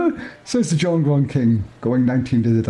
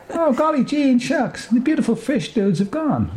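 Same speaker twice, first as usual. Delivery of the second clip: lamenting Moonbeam; 14 semitones higher than usual. Acted. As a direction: wail it like a soppy dreamer mourning the fish. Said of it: "laments Moonbeam".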